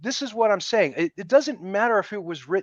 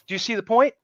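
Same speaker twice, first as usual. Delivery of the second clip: ordinary talk, nothing marked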